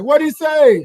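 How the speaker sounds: high, loud party call; hype call. Retold or imitated. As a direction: shout it to the crowd. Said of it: "So I said".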